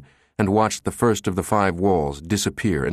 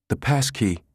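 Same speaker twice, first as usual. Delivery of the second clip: shivering; 3 semitones higher than usual. A narrator's reading. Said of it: "Susan shivered as she spoke".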